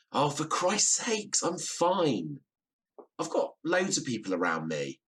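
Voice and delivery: high-pitched fed-up outburst at mum; exasperated. Acted. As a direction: snap it out, sick of being fussed over. Exasperated son on phone with mum.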